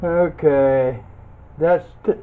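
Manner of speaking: ordinary talk, nothing marked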